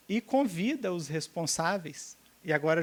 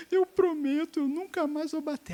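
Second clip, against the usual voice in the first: put-on high child promise; 10 semitones higher than usual. Son promises mother in a high child voice. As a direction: squeal the promise like a small child. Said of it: "Aí abre a boca".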